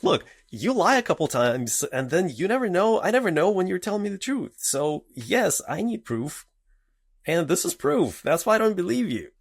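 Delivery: high, insistent retold argument; exasperated parent. Parent confronting daughter with proof, retold exasperated insistence.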